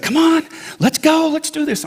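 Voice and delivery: high, chivvying coach-like urging; spunky. Retold or imitated. Therapist urging a reluctant patient, imitated spunky. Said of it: "She was spunky".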